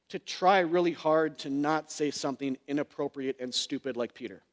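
ordinary delivery

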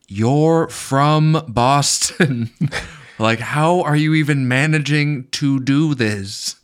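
high, loud, drawn-out incredulity; loudly incredulous, each word dragged out; exasperated disbelief. Real, not acted. Mock outrage at bad accent.

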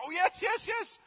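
very high squeal of relief; sudden excited recall. Acted. The man suddenly remembers a good deed, very high. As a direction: leap up with joy at remembering.

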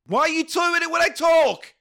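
high, loud outburst; exasperated shout. Real, not acted. Co-host interrupted by eating noises, exasperated high shout. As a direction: yell it in sudden annoyance. Annoyed at eating over his talk.

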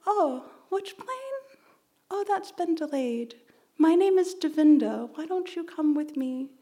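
a hint of a caring stranger leaning in; kind stranger. Helpful woman asking a crying teen, gently voiced.

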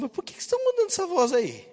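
mock puzzled dad. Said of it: "me imagino como pai olhando meu filho".

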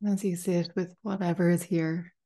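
calm meditation guide, long pauses between phrases; unhurried, spacious guided-meditation delivery. Acted. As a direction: ease listeners into stillness, phrase by phrase. Leads a guided meditation after chat.

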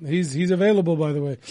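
usual speaking voice

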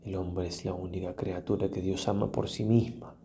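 ordinary delivery

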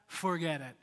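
low, softly dropped dismissal; understated, low-key write-off; dismissive. Retold or imitated. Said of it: "listen to the way he says".

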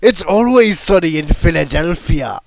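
loud, hammed-up celebrity impression; Danny DeVito. Co-host playing along with partner's guess, loud mock impression. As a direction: belt it out in a put-on character voice. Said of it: "Danny DeVito".

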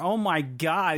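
a quick mock of a thick American accent; imitating strong American accent. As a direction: gush it like the actress in real life, broad American accent. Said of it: "she's got a really strong American accent".

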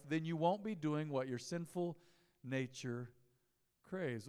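ordinary talk

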